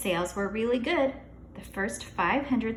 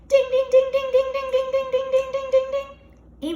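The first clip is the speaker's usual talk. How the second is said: email chimes; 8 dB louder than usual. Acted. Imitating email alerts.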